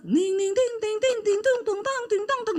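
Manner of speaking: high, quick sing-song piano plinks; imitated piano noodling. Preacher mocking her pianist husband's failed songwriting, high mimicked piano plinking. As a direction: mimic piano notes with nonsense syllables. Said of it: "dia nggak bisa bikin lagu. Kadang udah".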